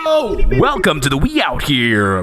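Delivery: slow mock newscaster opening; news anchor intro. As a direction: announce it like a morning news anchor. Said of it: "I love when you say the time".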